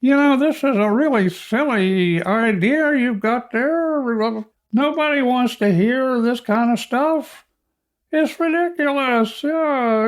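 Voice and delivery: very high-pitched cartoon squeak; squeaky, silly falsetto. Shown as a demonstration. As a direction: do a ridiculous high cartoon character as a nagging critic. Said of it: "So we'll go with a silly voice".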